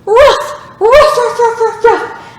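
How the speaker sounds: dog barking. Shown as a demonstration. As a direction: bark like a happy puppy. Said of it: "What sound does a dog make?".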